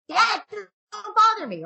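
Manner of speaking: put-on high, slow whiny teen brush-off; high, loud, dragged-out "leave me alone" teen voice; annoyed kid. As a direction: whine it out like a kid interrupted mid-game. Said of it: "your kid's like".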